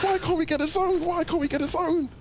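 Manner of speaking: very high whiny complaining voice; Queasy impression. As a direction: whine it high like a sulking player. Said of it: "that's not my greatest Queasy impression".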